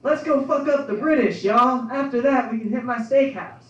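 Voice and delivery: high, loud hammed-up frontier president; Andrew Jackson. Comic imagining the president rallying troops, hammed-up yell. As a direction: bellow it like a rowdy old general. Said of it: "I can just see him".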